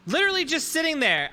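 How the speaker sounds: high, fed-up complaining; exasperated. Real, not acted. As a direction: whine about it. Companion not helping.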